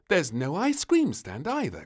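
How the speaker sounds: a touch of a grumbling queen; mild grumble. Acted. The queen complaining at the seaside, lightly grumbling. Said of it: "grumbled the queen".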